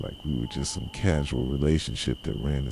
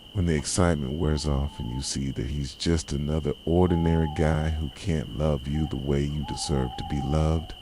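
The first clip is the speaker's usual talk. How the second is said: normal talking voice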